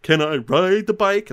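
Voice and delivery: high, loud jeering biker. Retold or imitated. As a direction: call out like a cocky drunk biker. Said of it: "He says".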